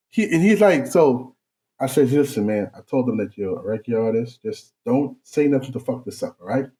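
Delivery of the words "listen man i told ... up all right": hushed warning. Retold or imitated. Quietly briefing brother in store.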